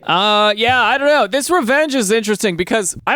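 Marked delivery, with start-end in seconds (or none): none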